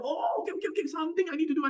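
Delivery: high, quick burst of recollection; acted sudden realization. Teacher acting out remembering a step, mock-excited and high. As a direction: rattle it off as if it just clicked.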